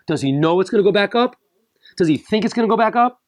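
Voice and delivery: climbing rhetorical challenge; rising incredulous questions. Real, not acted. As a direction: push the doubt. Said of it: "escalating questions".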